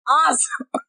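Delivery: normal talking voice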